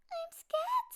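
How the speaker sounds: high, small scared cub voice; a frightened little cub, voice way up high; little bear voice. Acted. Child cub tells father his fear.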